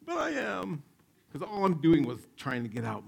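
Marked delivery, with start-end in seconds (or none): 0.0-0.8 put-on panicked kid. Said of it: "And I'm like".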